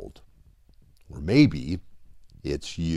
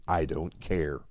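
gentle reassurance; 7 semitones lower, 6 dB quieter than usual. Acted. Father's repeated reassurance.

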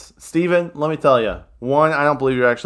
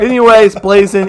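laughing; 10 semitones higher, 13 dB louder than usual. Real, not acted. Laughing, back to the question.

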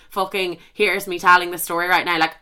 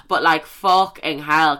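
ordinary delivery

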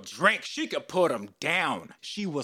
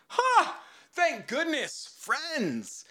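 retold relieved gasp; 13 semitones higher, much slower than usual. Retold relief at new friends.

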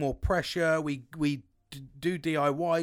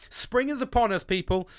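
excited; 10 semitones higher, 10 dB louder than usual. Real, not acted. Cheerful outburst on sunny Sunday.

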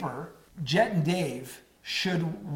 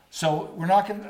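normal talking voice

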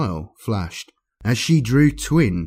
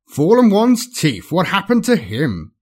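slurred speech; 9 semitones higher, 6 dB louder than usual. Acted. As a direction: slur it like a drugged noble. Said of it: "slurred Exuma".

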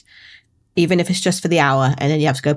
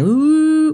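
wolf howl; 11 semitones higher, 4 dB louder than usual. Retold or imitated. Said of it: "of them going".

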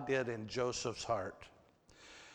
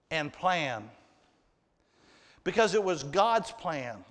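usual speaking voice

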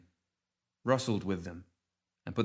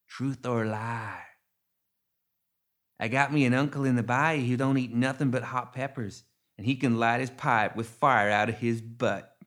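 Ma Tuckett; 3 semitones higher, 3 dB louder than usual. A narrator's reading. Ma Tuckett poses the riddle.

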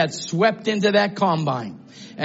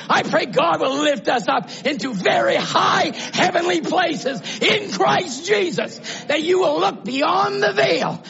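high, impassioned preacher's cry; fervent preaching; 9 semitones higher than usual. Real, not acted. Preacher praying God will lift the church up, fervent preaching. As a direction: lift the prayer up high and stretch it out.

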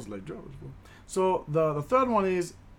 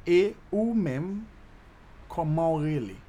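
"Et ou même, comment rele?" slowed down, each word stretched; slow teaching demo; 2 semitones higher than usual. Shown as a demonstration. Teacher repeating the Creole question for learners, "Et ou même, comment rele?" slowly.

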